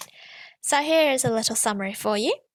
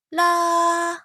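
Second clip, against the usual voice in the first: high, louder sustained la; high pitch la; 6 semitones higher, 6 dB louder than usual. Shown as a demonstration. Teacher showing students the high pitch, a high la. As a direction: sing la on a high level note. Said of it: "I'm gonna locate the high pitch".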